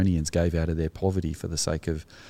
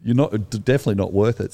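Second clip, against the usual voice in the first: louder, higher, teasing mate banter; mock Aussie mate; 4 semitones higher, 5 dB louder than usual. Rescuer mocks his wounded mate, loud and laughing. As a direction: rib him like an old digger mate. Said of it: "he probably said".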